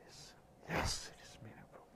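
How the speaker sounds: whispered aside, voice dropped to breath. Acted. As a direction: breathe it like a secret to the congregation. Preacher repeats point in hushed aside.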